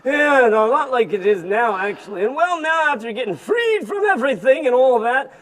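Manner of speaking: put-on tired, complaining old camel voice; grumpy old camel. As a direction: moan about aching back and feet. Camel grumbles about the desert.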